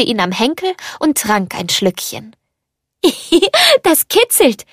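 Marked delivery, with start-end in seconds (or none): 3.0-4.7 put-on very high, childlike giggle; giggling. A narrator's reading. Little cup is tickled by the girl drinking, giggling. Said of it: "kicherte Tassilo".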